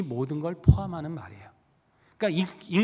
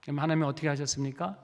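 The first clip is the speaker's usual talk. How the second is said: ordinary talk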